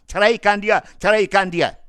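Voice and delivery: high, loud repeated order; firm command. Acted. The Prophet ordering the husband to divorce, loud firm command.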